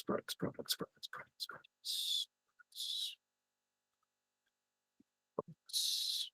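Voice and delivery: whispered, repetitive chant of "products"; whispered muttering. Real, not acted. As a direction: whisper "products" over and over, fading. Whispering while searching.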